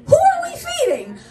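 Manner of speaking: very high, incredulous holler; exasperated mock shout. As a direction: cry out in exaggerated disbelief. Exasperated outburst to brother, retold.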